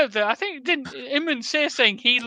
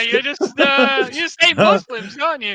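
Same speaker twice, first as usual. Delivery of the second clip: normal talking voice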